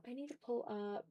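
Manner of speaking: ordinary delivery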